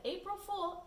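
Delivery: April Fool read high and teasing; a sing-song note that gets the last laugh. A narrator's reading. Mother's note, teasing and high. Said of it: "The note said".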